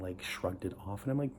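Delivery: ordinary talk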